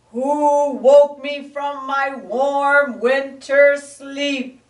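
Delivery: loud, slow, grumpy growl; snarling bear. Acted. Bear woken from winter sleep snarls at the animals. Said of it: "he snarled".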